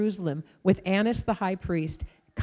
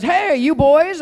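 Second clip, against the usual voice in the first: higher and louder, hailing them from a car; calling out; 7 semitones higher, 9 dB louder, slower than usual. Retold or imitated. Girl driving by calling to the boys, louder. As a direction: call across to the boys.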